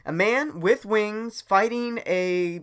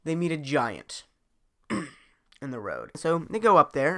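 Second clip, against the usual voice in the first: plain reading voice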